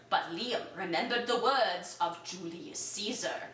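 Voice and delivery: ordinary delivery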